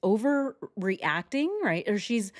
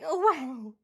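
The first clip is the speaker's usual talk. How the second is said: high-pitched fake anguished wail; mock crying; 9 semitones higher, much slower than usual. Commentator parodies her reaction to the crime, fake crying. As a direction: imitate a weepy outburst, climbing higher. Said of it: "She's like".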